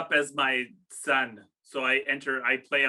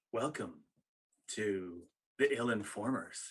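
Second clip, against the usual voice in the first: low, soft late-night radio host; sultry radio voice; 5 semitones lower, 8 dB quieter than usual. Shown as a demonstration. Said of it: "that I give you with my sultry voice, my radio voice".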